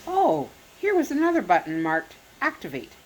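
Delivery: ordinary delivery, nothing marked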